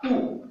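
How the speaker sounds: high, held "OU" as "oo"; sound OU. Shown as a demonstration. Teacher isolating the OU sound of O. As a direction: sing out "OU" for the class. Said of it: "La voyelle O se prononce OU".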